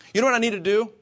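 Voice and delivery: ordinary talk, nothing marked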